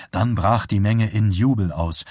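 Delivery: plain reading voice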